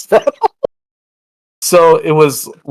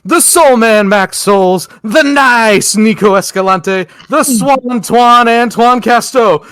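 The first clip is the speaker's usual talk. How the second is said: wrestling ring announcer; 8 semitones higher, 3 dB louder than usual. Acted. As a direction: announce like a ringmaster at the arena. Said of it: "I just did the wrestling intro bit".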